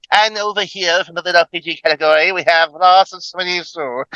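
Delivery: put-on announcer spiel, slowing at the end; mock category presenter. Imitating himself presenting a book category, announcer style. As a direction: present it like a hammy host. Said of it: "I'm over there, it's like".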